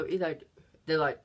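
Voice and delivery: usual speaking voice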